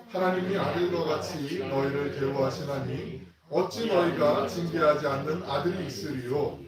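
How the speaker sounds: slow, loud, measured reading of the verse; slow unison scripture reading. Acted. Pastor leading the congregation in reading Deuteronomy, slow and loud. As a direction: read the Word out together, slowly and loudly.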